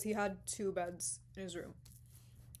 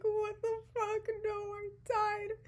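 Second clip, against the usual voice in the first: mock crying; 13 semitones higher, 3 dB louder, slower than usual. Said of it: "I just start crying".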